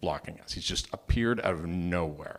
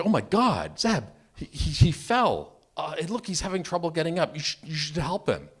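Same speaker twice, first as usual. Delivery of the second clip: higher-pitched mock of an alarmed, innocent wife; imitating concerned wife; 7 semitones higher, 3 dB louder than usual. Wife urges husband to help the fallen cyclist, imitated alarm. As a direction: play the worried wife, pitched up. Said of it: "nice, innocent person, she says".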